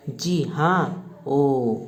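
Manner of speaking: slow, soft pained groan. Acted. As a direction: answer through a groan. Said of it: "कराह कर".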